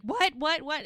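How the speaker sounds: panicked. Retold or imitated. Husband panics at labor remark.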